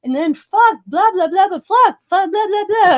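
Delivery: high, loud mock kid chatter; imitating swearing teens. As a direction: rattle it off like a cussing teenager. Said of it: "And one of them's like".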